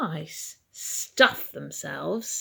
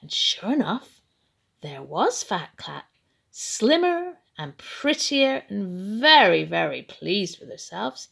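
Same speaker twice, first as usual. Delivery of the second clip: plain reading voice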